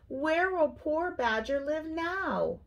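high, slow, dismayed question; gasping dismay. A narrator's reading. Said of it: "gasped Hedgehog".

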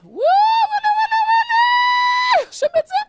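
very high, loud panicked yells; frantic shouting. Retold or imitated. Shouts heard from the Chafetz Chaim's house, frantic. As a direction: scream it in a high alarmed voice.

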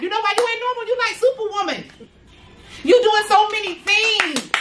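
high, lifted praise; excited praise. Real, not acted. Telling a fellow mom she's a superwoman, high excited praise. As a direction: cheer on a tired mom.

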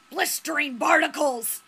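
acted captain's outburst; blustery sea captain. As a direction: burst out like a startled old sailor. Fisherman's Captain Haddock exclamation.

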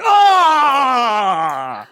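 high screaming at a witch; hammed-up horror-film shriek; exorcism scream. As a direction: shriek like a possessed nurse. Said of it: "і ви такі".

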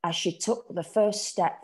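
ordinary delivery

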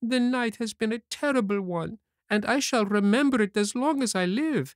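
put-on very high-pitched puppet child, pitch held up; whiny puppet Pinocchio. Hungry puppet tells Geppetto about his terrible night, high plaintive voice. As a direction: pour out your woes in a high little puppet voice.

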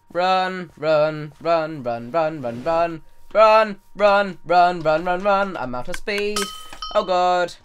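fleeing in a frantic rush; being chased. Real, not acted. Fleeing from a horse in game.

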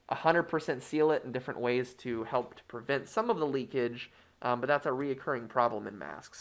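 muffled behind cloth mask; speaking through fabric covering; through a face mask. Real, not acted. Seen 5: Cloth face mask covers mouth and nose throughout; removed by 12.5.

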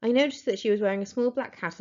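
ordinary delivery

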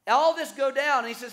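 ordinary talk, nothing marked

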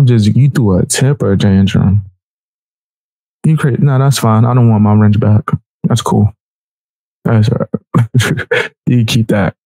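ordinary delivery

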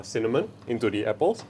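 normal talking voice

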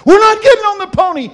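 put-on very high, loud child's scream; retold screaming. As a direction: scream the refusal like a panicking kid. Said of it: "started screaming".

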